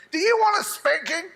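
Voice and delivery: high-pitched mock parental threat; threatening parent. Parent to a kid about a spanking, mock threat. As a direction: put on a scolding parent, pitched well up.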